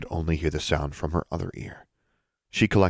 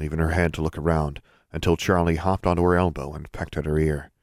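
plain reading voice

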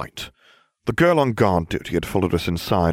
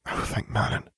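soft, airy sigh of relief; hushed, sighing relief; relieved sigh; 7 dB quieter than usual. A narrator's reading. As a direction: let the words out on a sigh. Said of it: "Hermione sighed".

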